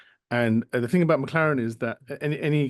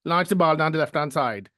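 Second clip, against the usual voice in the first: put-on higher, louder Caribbean accent; Shirley from the Desmonds; 4 semitones higher, 5 dB louder than usual. Host does McLaren as Desmonds' Shirley, Caribbean accent. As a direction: channel Shirley, Caribbean sitcom mum. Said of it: "that I channeled her".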